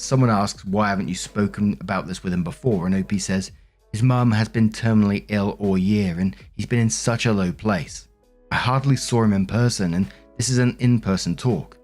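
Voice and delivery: ordinary delivery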